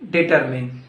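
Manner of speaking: "determine" ending in a short MIN; corrected DETERMINE. Shown as a demonstration. Teacher correcting learners, "determine" said as di-TER-min. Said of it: "No, it's not determine".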